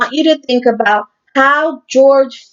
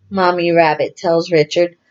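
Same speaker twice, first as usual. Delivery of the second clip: ordinary delivery, nothing marked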